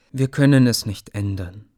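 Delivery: ordinary delivery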